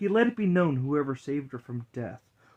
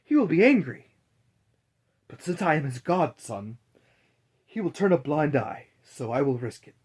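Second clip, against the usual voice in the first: anxious doctor; 2 semitones higher, 5 dB louder than usual. Acted. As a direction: talk yourself into the risk. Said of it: "thought the doctor".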